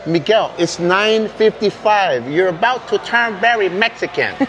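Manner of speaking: high, slow bossy scolding; barking tyrant boss. Pretend. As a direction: bark at him like a tyrant boss. Mock boss, very Mexican.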